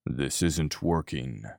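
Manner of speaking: hushed and slow, near the ear; low quiet voice. A narrator's reading. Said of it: "spoke low into his ear".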